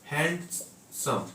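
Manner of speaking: Handsome said slowly in two parts, hand, then सम; split into syllables. Shown as a demonstration. Teacher showing students the two syllables, handsome slowly split as hand, सम. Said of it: "hand और सम".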